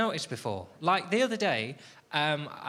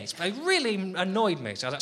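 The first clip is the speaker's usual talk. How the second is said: usual speaking voice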